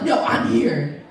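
loud, stretched-out declaration; mock-heroic and slow; emphatic announcement. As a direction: reenact grabbing everyone's attention. Repeats her announcement.

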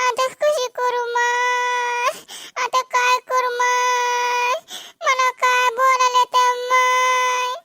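hammed-up crying to win sympathy; dragged-out mock weeping; fake crying. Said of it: "रडून जिरवा लागली".

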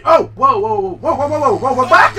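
loud panicked whoa whoa, high; scrambling away, alarmed shouts; startled yelling. Real, not acted. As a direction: yell at the attacker to back off. Startled by attacking enemy.

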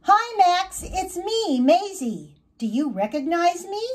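a hint of a cheerful young butterfly; Maisie's voice. Maisie's first words as butterfly.

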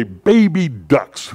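drawn-out, exasperated exclamation; imitating a flustered aunt's pet oath; upset Aunt Miriam. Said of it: "when she'd get upset".

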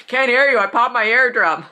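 half-shouted mock Kirk, walking past; Kirk deadpan. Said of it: "he just walks right past her and goes".

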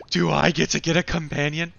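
loud, thrilled question; excited. Real, not acted. Player hopes for a bird companion, excited. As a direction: burst out with delighted hope.

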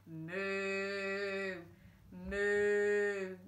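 drawn-out mooing cows. Acted. Woken cows fleeing the car, mooing. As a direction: moo like startled cattle. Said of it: "mooed the cows".

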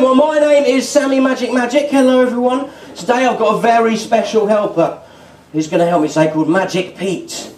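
ordinary talk

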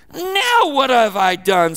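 loud, slow, wailing complaint; whiny protest. Acted. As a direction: cry out like a wronged kid brother. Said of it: "David sounds a little whiny".

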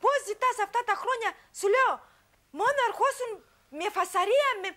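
high-pitched, heated protest at her husband; upset accusation. Real, not acted. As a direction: fire the complaints back at him, pitch climbing. Wife confronting husband on show. (αναστατωμένη, τσιριχτή διαμαρτυρία)

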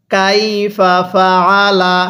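very slow "কাইফা ফাআলা"; model recitation. Shown as a demonstration. Teacher models it for learners, very slow measured "কাইফা ফাআলা". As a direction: stretch each syllable of "কাইফা ফাআলা" evenly. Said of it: "কিভাবে পড়ব".

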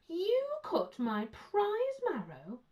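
very high, loud, drawn-out disbelief; outraged exclamation. A narrator's reading. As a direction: stretch every word in appalled astonishment. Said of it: "exclaimed Mister Brown".

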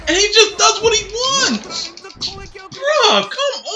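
high, loud complaining shout; indignant shout. Real, not acted. Opponent ignoring his moves, indignant shout. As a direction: yell it in sheer disbelief.

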